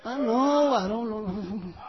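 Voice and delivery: softly trailing refusal; mock reluctance. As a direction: back away quietly, fading to almost nothing. People put off by substitute preacher.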